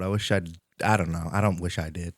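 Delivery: ordinary talk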